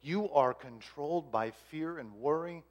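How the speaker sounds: slow, soft, gentle telling; tender, hushed correction. Retold or imitated. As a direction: break it to them softly and slowly. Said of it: "do it in a gentle way".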